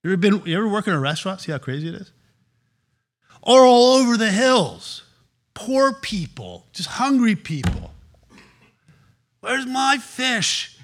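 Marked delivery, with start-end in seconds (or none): 3.4-7.9 higher, louder and slower crowd picture; swelling preacher cry. Acted. Preacher paints hungry crowd. 9.4-10.8 high, very slow whiny demand; hammed-up impatient diner; mock hungry crowd member.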